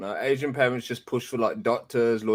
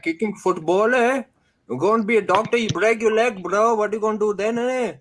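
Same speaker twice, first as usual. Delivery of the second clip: much higher, scolding parent impression, mocking; 9 semitones higher than usual. Mocking a parent who scolds his football dreams, high-pitched scold. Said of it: "The parents would be like".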